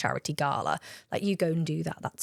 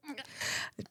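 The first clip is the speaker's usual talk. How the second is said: admiring gasp; 7 semitones higher, 14 dB quieter than usual. Retold or imitated. Seeing her date arrive.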